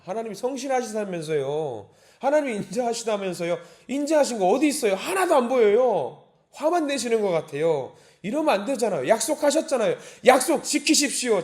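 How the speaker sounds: high, mounting acted complaint; confronting demand. Psalmist holds God to his promise, arguing indignantly. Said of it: "지금 따지고 있는 거거든요".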